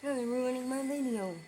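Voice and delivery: fed up with the noise; annoyed. Real, not acted. Annoyed by noisy cleaners.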